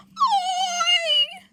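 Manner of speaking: high, loud, stretched shout; startled holler. Acted. Interrupting the talk, high stretched holler. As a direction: holler it like calling someone out.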